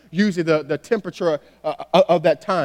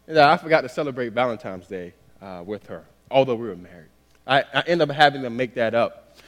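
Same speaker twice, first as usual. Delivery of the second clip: ordinary delivery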